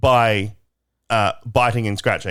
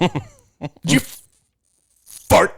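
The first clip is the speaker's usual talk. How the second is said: strained American fart; 6 semitones higher than usual. Retold or imitated. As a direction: push out "fart?" as if it takes effort. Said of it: "Americans make it a real effort".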